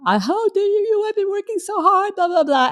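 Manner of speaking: high-pitched mock tirade, rattling on; mock ranting. Wife giving her husband smack, mock high-pitched ranting. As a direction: send up your own wailing pity-party rant. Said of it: "going at it".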